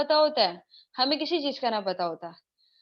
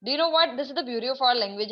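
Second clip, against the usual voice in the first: ordinary talk, nothing marked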